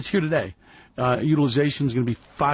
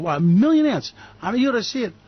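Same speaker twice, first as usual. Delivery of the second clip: amazed; 8 semitones higher than usual. Real, not acted. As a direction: marvel at the sight. Amazed by ship breaking crowd.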